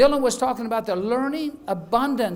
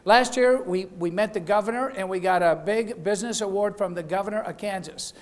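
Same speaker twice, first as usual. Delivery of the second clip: usual speaking voice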